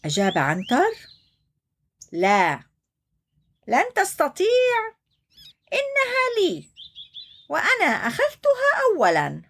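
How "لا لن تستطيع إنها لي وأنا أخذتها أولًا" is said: high mock-stubborn hippo; spoiled refusal. (عنتر يرفض بعناد طفولي)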